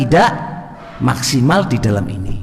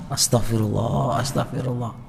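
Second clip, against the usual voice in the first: whispered istighfar; 9 dB quieter than usual. Shown as a demonstration. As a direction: breathe it out softly. Demonstrates seeking forgiveness.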